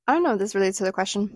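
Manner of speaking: ordinary delivery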